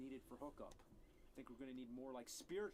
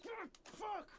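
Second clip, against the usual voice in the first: very high, loud curse of defeat; furious yell; 5 dB louder than usual. Real, not acted. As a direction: scream it as the drawing is ruined. Drawing fails again.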